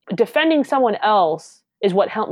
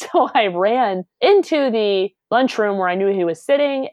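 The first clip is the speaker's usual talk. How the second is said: ordinary talk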